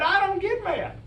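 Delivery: high, insistent denial. Real, not acted. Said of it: "I never think I get mad".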